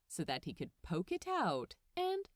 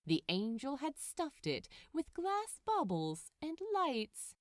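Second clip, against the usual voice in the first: usual speaking voice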